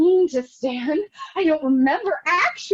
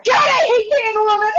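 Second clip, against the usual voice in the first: tearful outburst; 7 semitones higher, 5 dB louder than usual. Acted. Outburst in a bitter rant.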